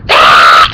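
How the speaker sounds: rough mock battle cry; berserk mimicked yell. Retelling friend charging at bullies, berserk mock yell. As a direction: yell like someone suddenly going nuts. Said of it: "you just went nuts".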